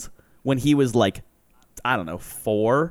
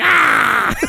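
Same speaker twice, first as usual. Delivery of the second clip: put-on a sudden high shouted "ah" like a defiant little kid; kid's high shout; 12 semitones higher, 5 dB louder than usual. Little cousin yelling back at his mom, high shout. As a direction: yelp it back, cheeky and loud.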